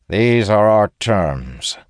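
slow, firm and final; unyielding. A narrator's reading. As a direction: lay down the terms, immovable. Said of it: "the general reaffirms, tone unyielding".

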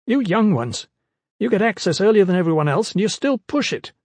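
high, quick, peevish scholar voice; scolding young scholar. Acted. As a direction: scold him like a prissy clerk.

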